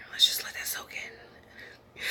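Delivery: slow, soft whisper to let it sink in; whispered, unhurried aside; hushed whisper. Real, not acted. Pausing for a point to sink in.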